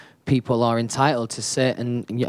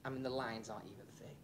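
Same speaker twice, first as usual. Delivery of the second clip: whispering; 12 dB quieter than usual. Real, not acted. Showing tattoos up close, off mic.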